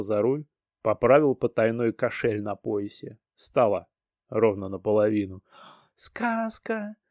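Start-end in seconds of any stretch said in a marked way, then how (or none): 5.9-7.0 put-on high child's voice; excited little girl. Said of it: "встрепенулась Дианка".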